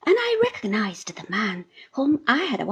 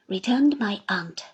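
plain reading voice

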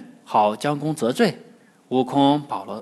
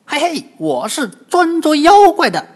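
high, loud, cheeky boast with a chuckle; loud cheeky monkey grinning at the old man; cocky Monkey King; 14 semitones higher, 8 dB louder than usual. Acted. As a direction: brag like a mischievous monkey. Wukong boasts to Gao Taigong.

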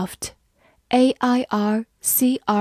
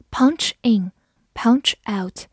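slow whispered repeat of punch in, punch out; much slower than usual. Shown as a demonstration. Teacher whispering the phrase back, slow. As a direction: breathe it out slowly for the class.

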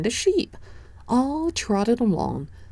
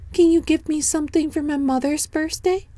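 put-on bright, much higher little boy voice; high child voice; 10 semitones higher, faster than usual. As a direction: speak up high like an eager small boy. Danny asks the sheep.

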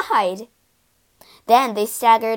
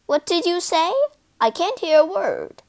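deafened owner; 7 semitones higher than usual. A narrator's reading. Owner cannot hear after scream.

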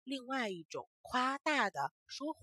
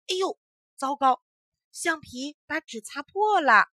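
dismayed exclamation; 5 semitones higher, 10 dB louder than usual. A narrator's reading. Dismay in narration.

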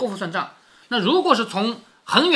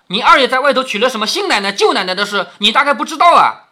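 louder, pointed interrogation with a high finish; accusing questioning; 4 semitones higher, 7 dB louder than usual. Acted. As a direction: grill a servant about a secret. 凤姐质问仆人. (大声质问)

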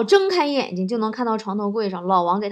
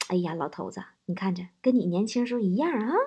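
soft, confiding aside; mysterious; 3 semitones lower, 7 dB quieter than usual. Acted. Mother-in-law confides to her old husband at dinner, mysterious. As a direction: act out a secretive mother-in-law. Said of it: "丈母娘一脸神秘的啊".

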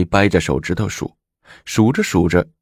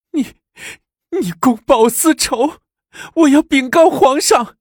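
very high, loud crying voice; stammer, then wail the threat; wailing protest; 4 dB louder than usual. A narrator's reading. Beaten consort cries out in protest. (哭喊着抗议)

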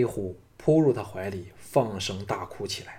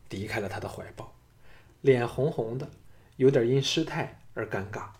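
usual speaking voice

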